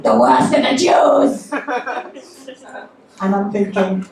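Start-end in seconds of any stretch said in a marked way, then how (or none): 0.0-1.5 high, loud, drawn-out declaration; mock outburst. Cab driver to passenger, mock loud outburst. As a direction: announce it slowly, hammed-up and loud.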